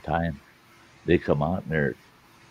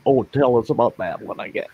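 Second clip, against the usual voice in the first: imitating pleading wife; 6 semitones higher, faster than usual. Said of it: "she's been begging me".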